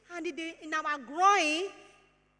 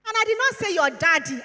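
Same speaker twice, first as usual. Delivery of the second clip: louder, "not" leaned on hard; emphatic; 7 dB louder than usual. Real, not acted. Mother warning her daughter about relatives, loud emphatic stress. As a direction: stamp the word "not", louder and firm. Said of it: "And I'm saying that emphatically".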